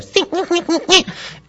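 very high wordless squeaks; squeaking noises. Retold or imitated. Six-year-old girl at her dog, squeaky noises. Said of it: "she's squeaking at this dog, you know".

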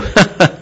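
bright little laugh of praise; praising laugh. Shown as a demonstration. Trainer demonstrating praise to a dog, happy laugh. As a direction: laugh warmly to reward the dog. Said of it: "and you go".

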